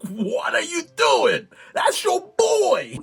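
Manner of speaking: slow, high, mock-anguished protest; exasperated reproach. Viewer scolds the friends for disloyalty, exasperated.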